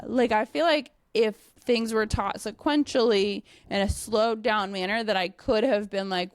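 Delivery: ordinary delivery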